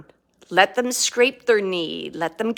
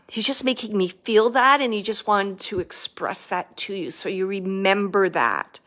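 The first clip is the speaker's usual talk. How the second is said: normal talking voice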